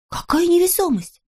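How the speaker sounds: high-pitched wondering; curious question. A narrator's reading. Villagers asking about weightlessness, intrigued. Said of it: "заинтересовались все".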